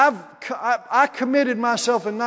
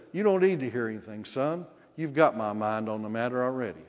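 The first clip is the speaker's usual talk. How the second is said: much lower, softer divine reassurance; the Lord's voice; 10 semitones lower, 7 dB quieter than usual. Retold or imitated. The Lord answering his son about a decision, low and quiet. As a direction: drop low and quiet, speaking as God. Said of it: "The Lord said".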